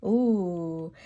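plain reading voice

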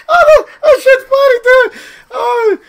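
very high squeak through laughter; breaking up. Real, not acted. Streamer amused by the fake, cracking up. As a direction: squeeze words out mid-laugh. Can barely talk from laughing.

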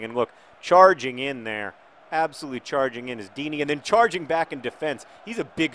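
usual speaking voice

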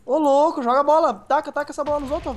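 high excited shouting; raging gamer. Real, not acted. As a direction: yell orders at the screen. Shouting orders during the game.

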